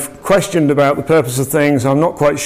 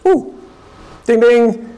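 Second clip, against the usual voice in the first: excited realisation; 7 semitones higher, slower than usual. Retold or imitated. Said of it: "So he goes ... in his head".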